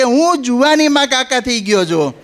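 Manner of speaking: shouted back loud and high; loud retort. Retold or imitated. Shouting back at the boys who teased him. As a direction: yell it back at the young riders. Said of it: "જોરથી બૂમ પાડી કે".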